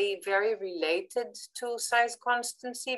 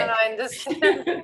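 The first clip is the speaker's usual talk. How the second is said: laughing; 7 dB louder than usual. Real, not acted. Listener reassures after joke.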